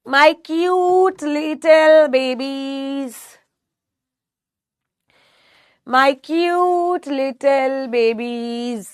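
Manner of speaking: very slow, stretched-out endearment; drawn-out coo. Real, not acted. Teacher slowly cooing at her class. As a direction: drag every word out fondly.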